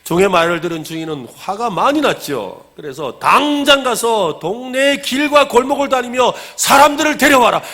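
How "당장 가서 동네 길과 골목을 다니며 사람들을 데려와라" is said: angry shouted order. Retold or imitated. Master angry at excuses, shouting orders at his servants. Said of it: "종들에게 소리쳤습니다".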